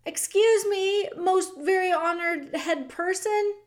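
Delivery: high, slow mouse straining to be loud; a tiny mouse calling up to a king; as loud as he can. Acted. The mouse calls up to the weeping king, as loud as a small voice goes. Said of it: "he spoke as loudly as he was capable of speaking".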